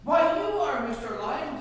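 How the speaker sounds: high-pitched frightened monkey; acted fear, voice jumping high; fearful monkey. A narrator's reading. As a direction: squeak out a nervous, flattering answer. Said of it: "said the monkey with fear in his voice".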